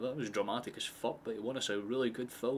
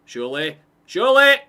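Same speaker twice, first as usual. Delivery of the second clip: each surely louder and higher into a scream; rising excited yell; 8 semitones higher, 21 dB louder than usual. Real, not acted. Streamer willing the ball into the net, building scream. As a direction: build from hope to full scream. Chance builds toward goal.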